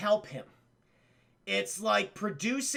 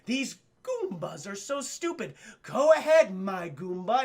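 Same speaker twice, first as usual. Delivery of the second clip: Dennis Hopper; 3 semitones lower than usual. Retold or imitated. Villain who loves saying Goomba, mock savoring menace. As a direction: relish every Goomba like a hammed-up villain. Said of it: "Dennis Hopper apparently just loves to say Goomba".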